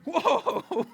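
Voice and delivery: real surprised laugh; startled whoa. Real, not acted. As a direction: caught off guard mid-sermon. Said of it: "I don't know if you saw that".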